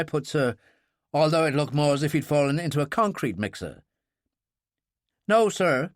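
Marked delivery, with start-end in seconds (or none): none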